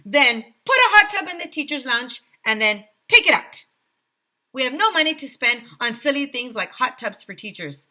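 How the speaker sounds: loud, high bossy orders; mayor barking silly orders; pompous mayor. A narrator's reading. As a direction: command it grandly. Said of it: "said the mayor".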